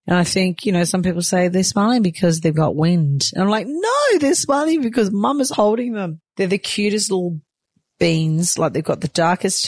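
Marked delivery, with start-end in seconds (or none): 3.7-6.2 playful retort. Retold or imitated. Retorting to the wind theory.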